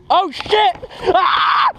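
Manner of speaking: sudden high yelp; startled shout. Real, not acted. Sudden hit in game.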